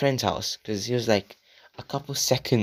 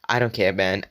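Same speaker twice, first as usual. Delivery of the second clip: normal talking voice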